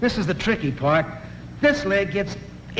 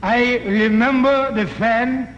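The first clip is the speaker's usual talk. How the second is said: slow, put-on Chinese accent; mock Chinese accent; 3 semitones higher, 4 dB louder, slower than usual. Shopkeeper answering the customer in a lightly mock Chinese accent. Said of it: "the little Chinese man, he says".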